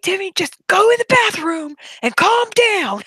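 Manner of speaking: high mock voice of the boss, soothing. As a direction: play the boss telling her to calm down. Boss voiced in retold story.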